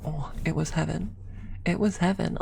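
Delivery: usual speaking voice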